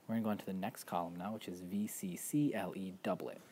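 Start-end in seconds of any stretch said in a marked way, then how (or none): none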